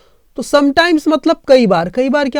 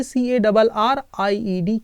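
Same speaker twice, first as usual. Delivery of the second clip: drawn-out spelling for the class; slow spelling; 4 dB quieter, much slower than usual. Shown as a demonstration. Teacher spelling a past form slowly for students. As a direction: spell सीएडबलआरआईईडी letter by letter, slowly. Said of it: "इसकी past form कैसे बनेगी".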